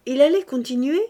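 usual speaking voice

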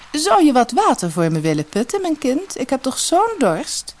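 high, genteel lady's request; fine lady. Acted. Fairy disguised as rich lady asking water, high. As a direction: ask sweetly, a touch grand. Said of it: "de fee ... als rijke dame".

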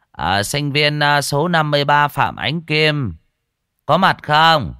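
high, loud calling voice; professor calling out. Acted. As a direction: call the next student from the door.

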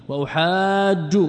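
وأحاج slowly, as "wa-uhaajju"; وأحاج with a stretched alif and doubled j; doubled jim. Shown as a demonstration. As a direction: hold the long "aa", then double the jim in وأحاج. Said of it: "بتشديد الجيم".

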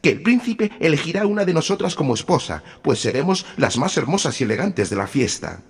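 put-on high, loud conceited girls; boastful vain sisters. A narrator's reading. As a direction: preen and boast. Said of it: "dijeron las dos presumidas jovencitas".

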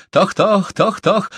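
high, loud rhythmic driving call; dog-driving call. A narrator's reading. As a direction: urge on sled dogs. Shaman imitates driving the dogs.

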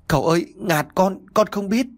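high, distressed servant voice; choked pleading. A narrator's reading. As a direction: plead as if grabbed by the collar. Grabbed by collar, pleading servant.

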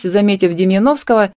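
usual speaking voice

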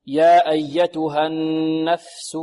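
slow recitation, long nasal hold on the n of "النفس"; held ghunnah. Shown as a demonstration. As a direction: hum through the doubled n in "النفس". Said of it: "عندنا النون هنا مشددة". (غنّة طويلة في نون "النفس")

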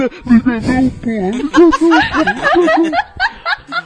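high panicked shriek, laughing; scared yell. Retold or imitated. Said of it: "Aí ele dá um grito".

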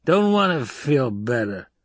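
put-on slow, sullen drunk voice; drunken refusal. Idaho refusing the doctor's drink, drunkenly stubborn.

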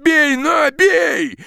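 high, loud ferocious shout. Acted. Peasant daring the forester to strike, ferocious shout. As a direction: dare him to hit you. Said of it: "подхватил мужик свирепым голосом".

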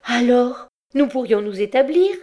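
high, ringing, triumphant question; thrilled young woman leaping at the idea; triumphant cry. A narrator's reading. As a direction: exclaim it in triumph, pitch soaring. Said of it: "Elle s'écria triomphante".

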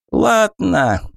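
strained, grunting goblin; groaning voice. Acted. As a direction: grunt it out with effort. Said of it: "донёсся кряхтящий голос Керка".

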